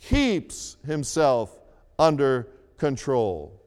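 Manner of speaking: "keeps himself" slow, each word leaned on; slow right down on "keeps himself under control"; stress on keeps himself. Shown as a demonstration. Pastor pointing the church to "keeps himself", said slowly. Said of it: "Notice the words".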